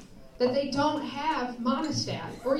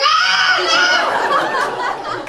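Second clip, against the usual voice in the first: much higher, much louder yell of alarm; panicked yell; 10 dB louder than usual. Retold or imitated. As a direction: blurt it out like a sudden shock. Sudden painful surprise in bed.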